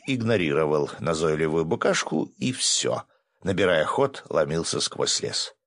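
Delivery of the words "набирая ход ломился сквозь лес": plain reading voice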